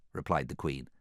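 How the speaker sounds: ordinary delivery, nothing marked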